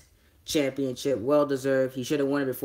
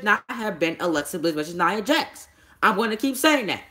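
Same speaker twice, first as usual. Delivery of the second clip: excited rant; 9 semitones higher, 6 dB louder than usual. Real, not acted. Ranting about the booking choice.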